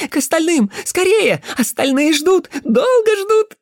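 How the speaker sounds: very high, loud cawing urgency; excited bird-boy. Acted. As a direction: hurry her in excited crow-like cries. Excited boy urges her to hurry. (возбуждённые высокие выкрики)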